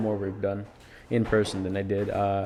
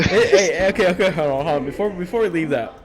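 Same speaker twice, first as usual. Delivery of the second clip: excited; 7 semitones higher, 6 dB louder than usual. Real, not acted. Interrupting excitedly, laughing.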